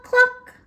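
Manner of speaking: very high clucking; chicken cluck. Shown as a demonstration. Teacher clucking a quiz sound for kids. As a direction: cluck like a hen.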